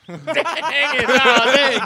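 high and loud through laughter; laughing. Real, not acted. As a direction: crack up while teasing. Laughing at a fumbled pun.

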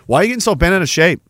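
ordinary delivery

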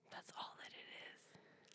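hushed whisper. Real, not acted. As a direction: say it under your breath. Whispered aside.

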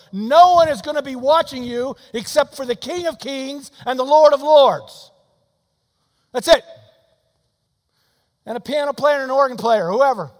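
loud, rising revival shout, pushing harder each line; full-force preaching. Acted. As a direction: preach it out to the back pew, building to a roar. Climax of the altar call.

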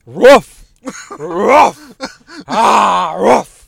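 loud barking rough; dog bark. Pretend.

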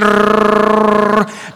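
long rolled trill; trilled whirring noise. Acted. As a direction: mimic a whirring search with a rolled r. Mimics a mind racing for names.

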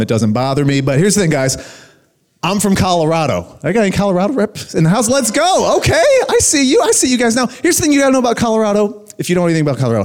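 normal talking voice

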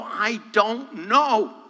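slow, drawn-out confession; a touch of bewildered defeat; helpless. Retold or imitated. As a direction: let it hang, lost and beaten. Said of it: "I'm gonna go".

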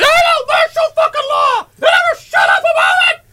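high-pitched mocking shout. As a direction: squeal it like a whiny kid. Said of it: "'Cause it goes".

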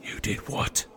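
hushed shocked whisper. Acted. Repeats hushed after shush.

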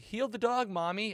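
mock child's plea to mom; imitating the kids. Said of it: "And the kids are like".

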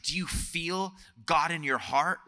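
slow, lightly voiced little preacher; imitated child preacher. Five-year-old preaching to dad and sister from the mantel, mock-solemn. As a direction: draw it out like a child playing preacher. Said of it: "preached the gospel".

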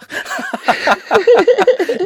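high, loud burst of laughter. Real, not acted. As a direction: crack up loudly.

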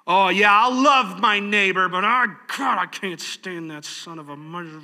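a hint of fake enthusiasm souring; lightly hammed-up grudging neighbor; grudging churchgoer. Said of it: "serve God in the same fashion".

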